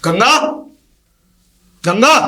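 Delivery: loud, high call across the house; husband calling his wife, loud. Acted. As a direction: call her name loud to reach the next room. Husband calls wife from afar.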